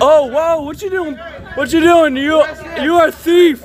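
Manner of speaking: real shout at the fleeing thief; angry accusing shout. Real, not acted. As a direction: hurl the accusation at him.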